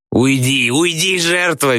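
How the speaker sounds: high, drawn-out theatrical squeal. A narrator's reading. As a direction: squeal it high without extra volume. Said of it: "визжит Градусов". (высокий протяжный визг)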